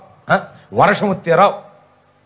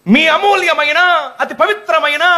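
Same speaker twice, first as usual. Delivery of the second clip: higher, sing-song campaign loudspeaker voice; election announcer; 8 semitones higher than usual. Retold or imitated. Preacher mocking the same old election announcer voice, pitched higher. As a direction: ham up the old election mike man.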